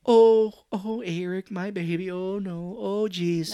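high, fake tearful lament; mock grieving mom. Imitating the mom mourning her son, high and mock tearful. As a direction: lament like a hammy mother. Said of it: "the mom's like".

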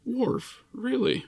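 high, disbelieving question; surprised. Real, not acted. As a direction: ask in sheer disbelief. Surprised at her favorite.

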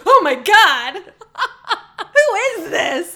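loud, high mock amazement; retold shock. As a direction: gasp it out as a laughing retelling. Retold shock on seeing actor.

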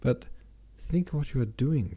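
understated cry of alarm. A narrator's reading. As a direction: plead with some urgency. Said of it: "cried Sonya".

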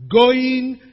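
loud, high auctioneer cry; auctioneer's call. Acted. Preacher likens tears' end to an auction, auctioneer's call. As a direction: call it out to the whole sale room. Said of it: "like the auctioneer says".

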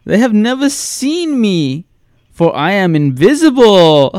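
reading a killer's letter in a high, hammy put-on voice; Tommy Wiseau impression. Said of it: "I'll just read it like that then".

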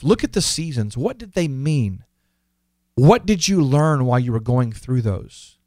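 normal talking voice

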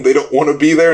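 normal talking voice

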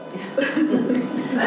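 breathy high laugh; quick amused laugh; airy laugh. Real, not acted. Laugh inviting audience answer.

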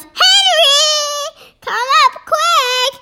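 high, urgent yell for help. A narrator's reading. Mummy summoning her husband to the bathroom, yelling. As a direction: call upstairs-style, high and drawn out. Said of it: "and she yells".

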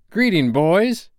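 high, ringing greeting; crying out. A narrator's reading. Peter returning to his boys, high cried greeting. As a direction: hail them high and strong. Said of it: "he cried".

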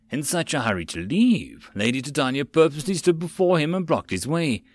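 plain reading voice

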